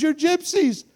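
high, loud exclamation; imitated astonished boy. Astonished boy in retold scene.

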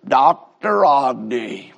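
low, slow Alabama Texan drawl of a friend; lightly voiced Alabama Texan greeting; a hint of an Alabama Texan friend on the phone; Alabama Texan accent. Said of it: "that Alabama Texan accent".

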